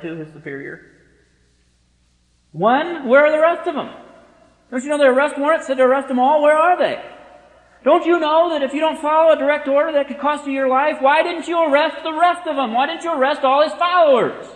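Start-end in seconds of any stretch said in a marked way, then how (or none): 2.5-14.5 high, loud angry interrogation; furious commander. Pretend. Commander to his officer about missing followers, furious shouting. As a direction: berate him like a raging superior. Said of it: "Imagine the commander ... going back".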